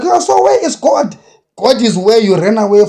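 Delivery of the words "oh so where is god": high, sneering mock question; mocking skeptic. Preacher mocking doubters, in a high sneering voice. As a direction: squeak it like a scoffing doubter. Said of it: "People are like".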